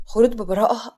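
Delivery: ordinary delivery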